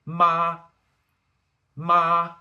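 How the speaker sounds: long, loud, high level MA; first tone. Shown as a demonstration. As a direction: sing out MA on one high, flat pitch. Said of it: "repeat the first ten second tones".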